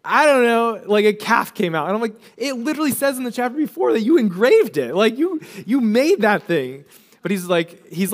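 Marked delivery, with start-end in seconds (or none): 0.0-1.9 loud, high mock-clueless protest; comically imitating a culprit playing dumb; Aaron's excuse. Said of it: "'cause Aaron's like". 2.4-6.8 high-pitched, exasperated comeback; playful scolding, pitched up; mock incredulous. Mock-scolding Aaron over his excuse.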